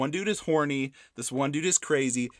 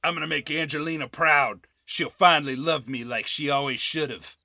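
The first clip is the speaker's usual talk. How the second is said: a mild hammed-up actor voice, a bit higher and louder; Jon Voight impression; 4 semitones higher, 5 dB louder than usual. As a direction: play the vain, lovesick actor, lightly mocking. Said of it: "however you wanna imitate him".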